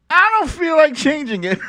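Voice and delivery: high, giggling through the words; giggly stubborn admission; laughing. Real, not acted. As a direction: laugh it out, amused at yourself. Laughing at herself.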